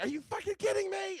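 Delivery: retelling his wife's disbelieving outburst, high and indignant; incredulous outrage. Retold or imitated. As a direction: imitate the wife's shocked fury. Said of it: "She's like".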